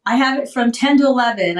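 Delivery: ordinary talk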